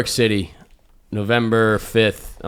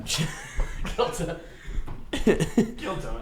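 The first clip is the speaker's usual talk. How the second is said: laughing mimicry; 5 semitones higher, 8 dB quieter, much slower than usual. Mimicking show name in goofy voice.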